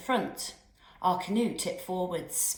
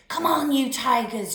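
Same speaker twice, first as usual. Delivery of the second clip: loud, higher yell across the water; 6 semitones higher, 6 dB louder than usual. A narrator's reading. Giles urging his teammates, loud yell. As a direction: shout them on like a coach. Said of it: "Giles yelled".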